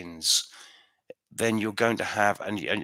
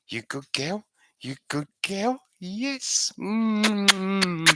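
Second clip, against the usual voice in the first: questions rising into a high coo; cooing pet talk; 9 semitones higher, much slower than usual. Real, not acted. Owner fussing over a good dog, rising doting coo. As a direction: coo to a pet, climbing higher as you go.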